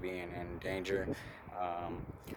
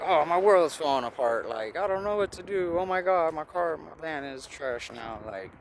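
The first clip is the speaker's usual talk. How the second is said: mock freak-out; 7 semitones higher than usual. Retelling his panic over the broken van, mock freak-out. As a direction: act out losing it over the van. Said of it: "freaking out and stuff".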